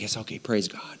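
soft, low and unbothered, as if nothing can rattle you; serene calm. Retold or imitated. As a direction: let it float out, totally at peace. Calm believer with annoying coworker.

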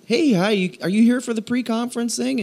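high, eager hello to a stranger; friendly greeting. Retold or imitated. Pastor welcoming a stranger outside church, high eager greeting. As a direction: greet her like a cheerful host.

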